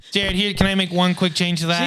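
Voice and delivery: usual speaking voice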